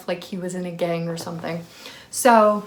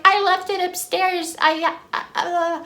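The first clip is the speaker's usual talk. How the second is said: high-pitched, flustered excuse; mocked roommate; 10 semitones higher than usual. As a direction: mimic her spluttering in a high voice. Imitating the yelling roommate's excuse.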